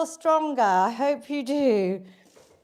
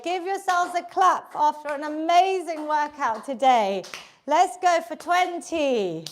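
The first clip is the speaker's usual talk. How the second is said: ordinary talk, nothing marked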